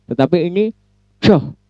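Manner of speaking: "coh" tebal dengan lidah ditekuk ke atas, nada tinggi. Shown as a demonstration. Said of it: "kalau C tipis". ("coh" tebal, bukan "C" tipis, nada lebih tinggi)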